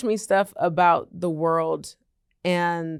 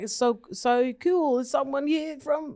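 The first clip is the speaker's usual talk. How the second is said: real Australian guy; 7 semitones higher than usual. Retold or imitated. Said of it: "and he's like".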